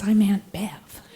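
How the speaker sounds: laughing whisper aside; embarrassed whisper. Retold or imitated. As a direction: hiss it out like a mortified confession.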